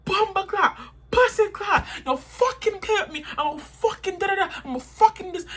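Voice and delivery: high, fast Jamaican patois rant; imitating Diana's Jamaican accent. Narrator imitates Diana on ten in a Jamaican accent, pitched high. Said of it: "Diana's accent was like mine".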